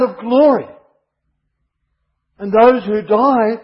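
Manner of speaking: high, loud, ringing sermon voice; full-force preaching. Acted. Preacher proclaiming Christ's return to the congregation, at full force. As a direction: proclaim the coming glory to the whole church.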